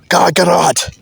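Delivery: a hint of Vegeta yelling Kakarot; Vegeta shout. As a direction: bark the name like Vegeta. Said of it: "that's Vegeta's voice".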